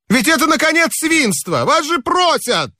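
high, exasperated complaint; a sleepless guest scolding revellers; indignant scolding. A narrator's reading. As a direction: scold them for their noise. Narrator scolding noisy neighbours.

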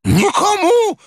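put-on high, agitated old man's voice; shrill, worked-up protest; heated old man. A narrator's reading. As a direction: cry out in high-pitched outrage. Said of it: "горячился старик".

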